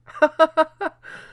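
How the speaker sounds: short high rising laugh; high giggle. Real, not acted. Laughing at his super chat.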